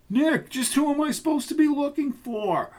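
a high, anxious junkyard hand; whiny henchman. Acted. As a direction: play the put-upon lackey. Roy questioning Rizzo.

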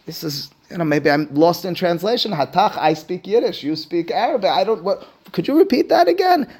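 hammed-up confusion, rising to a high-pitched question; baffled Mordechai. Mordechai questions messenger Hatach about Esther's words, baffled. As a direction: play someone lost in translation, incredulous by the end. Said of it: "I'm imagining Mordechai in the moment".

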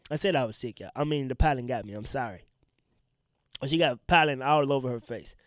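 usual speaking voice

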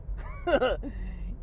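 a high, airy giggle; goofy laugh. Real, not acted. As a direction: cackle at your own joke. Laugh after acting out commands.

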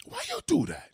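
put-on high Haitian-accented catchphrase; Haitian father. Repeating the father's catchphrase for laughs, high Haitian accent. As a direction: throw out the mock Haitian dad protest.

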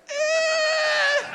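very high shrieked "Eh"; high frightened squeal. Retold or imitated. Preacher imagining picking up a snake, squealing high. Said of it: "I would have been like".

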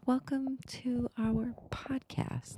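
breathy whispered welcome; whispering. Pretend. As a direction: whisper it like a secret. Greeting repeated as whisper.

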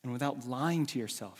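ordinary talk

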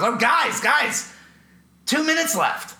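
high, loud mock alarm; mock urgent call. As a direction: call out to the band as if time is running out. Imitating singer's panicked call at timer.

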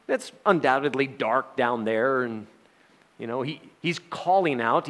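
ordinary delivery

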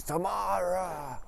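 long, high, slow mock cry; falling cry. Husband falls off the cliff, drawn-out mock cry. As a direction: stretch out the name as he falls.

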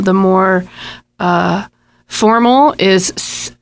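ordinary talk

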